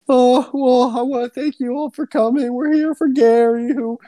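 high, slow mock eulogy; crying housewife thanking the mourners, mock solemn; mock grieving housewife. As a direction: ham up a tearful widow's thanks. Mocking a crying widow.

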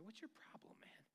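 hushed, disgusted at himself; self-scolding whisper. Pretend. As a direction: whisper it harshly at your own reflection.

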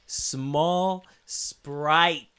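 very slow, each word laid out; slow, spelled-out order. Retold or imitated. Customer correcting the drive-thru lady, very slow. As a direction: say it slowly so she gets it.

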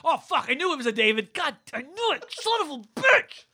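loud, rising frustrated swearing; frustrated cursing. Real, not acted. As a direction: yell it at yourself for missing it. Missed trivia answer, cursing.

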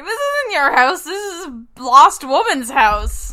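loud, high, slowing insistence. Acted. Sophie telling the homeowner it's not his house, loud and firm.

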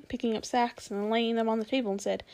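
plain reading voice

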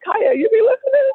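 high, amazed question; mock surprise. [name] teasing her friend, high and amazed. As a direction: act stunned she listens. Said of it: "I was like".

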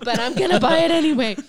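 loud, high laughing quote; laughing surrender. Retold or imitated. Telling friends about splurging on vinegar, laughing mock surrender. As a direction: give in with a big, laughing voice. Quoting own giving in, amid laughter.

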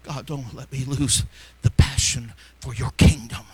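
low, breaking into whisper on passion; hushed plea. Real, not acted. Preacher begging God to keep his passion, hushed prayer. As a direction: pray it low, with long heavy pauses.

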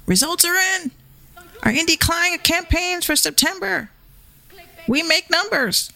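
high, smug announcer mock of the post; mocking boastful post. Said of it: "this is what he posted".

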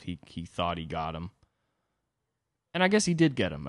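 ordinary talk

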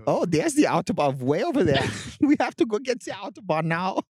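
high, hammed-up German accent; sing-song mock German accent. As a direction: get worked up in a silly German accent. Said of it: "his German accent is fucking groundbreaking".

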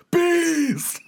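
high, loud yell of alarm; hammed-up, high-pitched panicked holler; acted yelling. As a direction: yell it as a man swarmed by bees. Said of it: "A man is just yelling".

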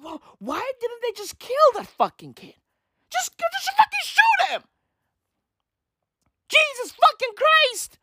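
high, squealing comic outrage; exasperated ranting. Acted. Comedian wishing a TV kid killed off, exasperated squeal. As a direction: build to a high-pitched mock fit. Exasperated rant about killing the character.

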